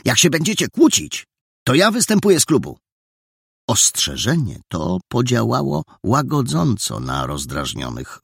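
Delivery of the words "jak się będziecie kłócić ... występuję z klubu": high, loud and fast outburst; little boy fed up with the quarrel; angry threat. Acted. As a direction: bang the table and warn them. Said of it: "uderzył pięścią w stół".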